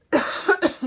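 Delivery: sharp loud cough; one hard cough; real cough. Real, not acted. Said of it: "Sorry, I'm coughing".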